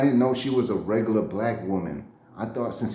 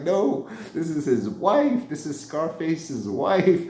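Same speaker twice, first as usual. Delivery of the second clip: nervous; 5 semitones higher, slower than usual. Retold or imitated. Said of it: "like, nervous".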